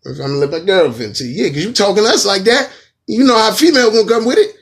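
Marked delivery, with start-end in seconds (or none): 1.4-4.6 loud, high, fast street threat; mock threat. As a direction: bark it like you mean it. Retelling threat to [name].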